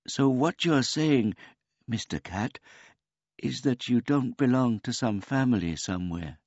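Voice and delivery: put-on high, polite old man voice; a gentle, childlike old man; simple old man. A narrator's reading.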